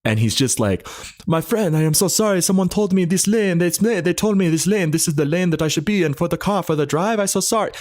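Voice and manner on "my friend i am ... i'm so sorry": put-on high, apologetic thick Armenian accent. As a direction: play the sorry driver in a heavy Armenian accent. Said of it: "lays on the thickest accent you've ever had".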